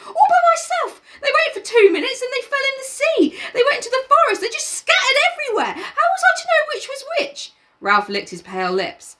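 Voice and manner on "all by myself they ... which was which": much higher protesting schoolboy; indignant Piggy. Acted. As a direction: defend yourself, getting frantic. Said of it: "cried Piggy indignantly".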